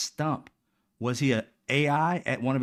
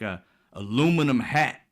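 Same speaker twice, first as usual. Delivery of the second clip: normal talking voice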